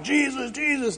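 playful caricature of tattooed believers; mocking tough guys. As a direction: ham up the tough dudes' devotion. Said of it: "they're all like".

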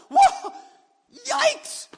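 high, loud startled yelp of alarm; startled cry. Acted. Preacher acting out the crowd's alarm with a yelp. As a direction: yelp like someone seeing fire fall.

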